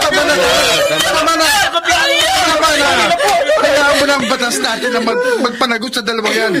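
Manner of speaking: high, pleading shouts to stop; urgent shouting. Acted. Friend shouting to pull Isabel away from the suspects. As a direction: yell at her to stop.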